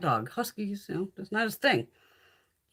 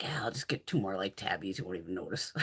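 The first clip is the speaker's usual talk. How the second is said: whispered, much softer scheming aside; sneaky whisper; 13 semitones lower, 9 dB quieter than usual. Retold or imitated. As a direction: whisper it like a plan he won't hear. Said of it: "I'm like".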